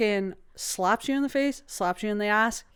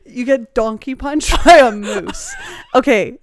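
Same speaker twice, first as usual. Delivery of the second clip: talking through laughter; laughing; 8 dB louder than usual. Real, not acted. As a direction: laugh while saying it. Laughing at the joke.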